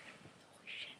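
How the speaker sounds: barely audible, voiceless whisper; overwhelmed whisper. Real, not acted. Fan reliving meeting Harry, overwhelmed whisper. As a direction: whisper it in disbelief.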